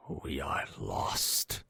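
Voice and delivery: slow, low, breathless whisper. Acted. Young man under the bed whispering in despair. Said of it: "whispered the young man".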